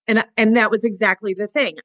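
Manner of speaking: normal talking voice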